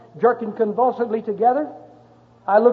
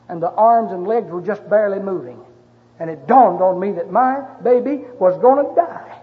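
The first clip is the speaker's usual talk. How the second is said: usual speaking voice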